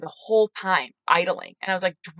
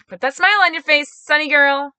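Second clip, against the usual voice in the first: higher, louder, sunny self-coaching; perky pep talk; 6 semitones higher, 7 dB louder than usual. Retold or imitated. Woman coaching herself before facing a stranger, perky pep talk. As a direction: put a bright smile in the voice.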